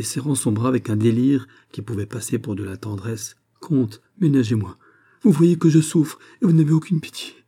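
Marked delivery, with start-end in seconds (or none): 3.6-7.3 high, strained, tearful plea; pleading through tears. A narrator's reading. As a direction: beg him, on the edge of sobbing. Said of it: "les larmes étouffèrent sa voix".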